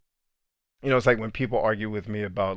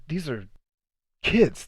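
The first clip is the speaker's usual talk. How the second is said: usual speaking voice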